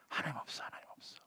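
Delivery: sinister unvoiced whisper; hissing whisper. The tempter whispering to the psalmist, voiceless and sinister. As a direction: whisper it like a voice of doubt.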